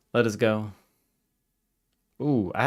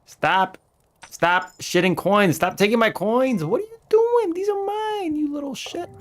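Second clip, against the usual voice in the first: much higher, louder frantic yells rising to a squeal; squealing high shout at a coin-grabbing villager; frantic outrage; 4 dB louder, slower than usual. Real, not acted. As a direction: shriek like your savings are being stolen. Frantic yelling at NPCs.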